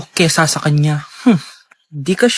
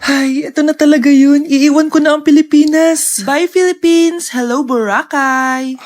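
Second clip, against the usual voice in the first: excited farewell; 9 semitones higher than usual. Acted. Traveller on the plane waving goodbye to the country, high gleeful farewell. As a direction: sing out the goodbye with glee.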